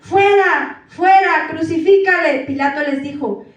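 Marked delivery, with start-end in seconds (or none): 0.0-2.4 loud, high repeated cry; loud shouted demand; mob shout. A narrator's reading. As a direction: yell it like a furious crowd. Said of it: "ellos gritaron".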